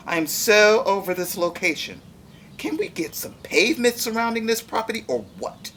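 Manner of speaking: high, loud whiny complaint; petulant corn griping about dust; complaining corn. Acted. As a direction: whine like a spoiled diva crop. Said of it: "the corn complained".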